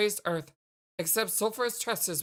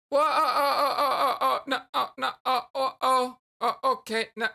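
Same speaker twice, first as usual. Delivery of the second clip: higher and louder, tripping over uh and no; flustered stammer; 3 semitones higher, 4 dB louder than usual. Real, not acted. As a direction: caught off guard, babbling denial. Flustered by question about crush.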